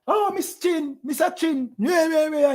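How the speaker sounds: high, simpering fawning over a guest; fawning mock greeting. Mocking his brother fawning over the Japanese visitors, high and simpering. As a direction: gush sweetly like a flatterer. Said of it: "Come round, you".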